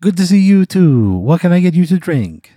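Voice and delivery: high, loud bad Italian accent. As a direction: belt it like a hammy Italian waiter. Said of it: "a really bad Italian accent".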